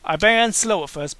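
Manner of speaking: ordinary talk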